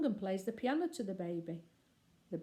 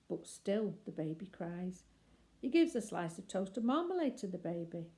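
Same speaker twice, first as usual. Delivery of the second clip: ordinary delivery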